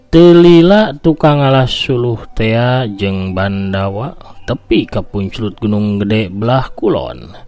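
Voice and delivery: usual speaking voice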